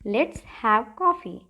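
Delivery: very slow and even; slow drill. Shown as a demonstration. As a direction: lay out each word for the class. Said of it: "या फिर आप कह सकते हो".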